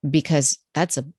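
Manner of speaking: normal talking voice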